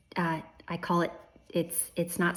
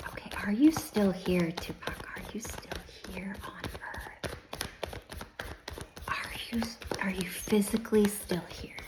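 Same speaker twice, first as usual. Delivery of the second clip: whispered question to a spirit; hushed, secretive asking; whispering; 2 semitones higher, 3 dB quieter than usual. Real, not acted. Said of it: "I feel like I have to whisper".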